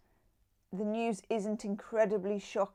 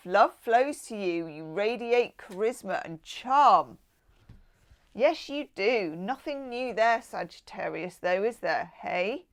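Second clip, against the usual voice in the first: ordinary delivery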